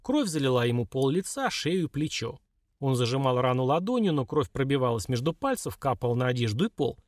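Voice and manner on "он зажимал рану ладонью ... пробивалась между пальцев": plain reading voice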